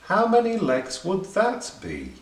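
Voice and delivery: high, wondering question; lightly voiced rabbit wonders aloud; rising question. A narrator's reading. Rabbit asks centipede in poem.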